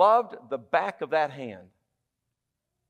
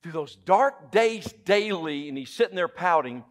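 usual speaking voice

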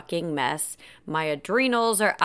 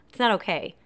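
ordinary talk

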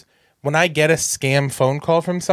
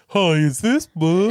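badly faked stranger's voice, slow; poorly disguised voice; 3 semitones higher than usual. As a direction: put on a clumsy fake voice. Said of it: "disguised my voice poorly".

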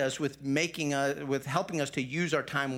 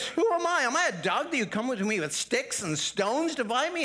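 higher, indignant Goliath; insulted giant; 7 semitones higher than usual. Retold or imitated. Goliath mocks David for coming with sticks, indignant. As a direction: sneer it out as the offended giant. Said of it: "he's insulted".

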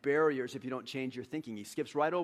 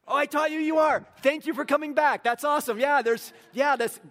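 preaching at full force; 8 semitones higher, 8 dB louder than usual. Acted. Preacher jokes with congregation, loud.

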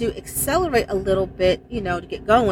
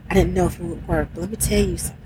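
ordinary talk

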